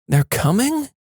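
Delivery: high, rising, questioning echo; puzzled question. A narrator's reading. Wife questioning sleeping husband, puzzled. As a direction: echo it back high in disbelief.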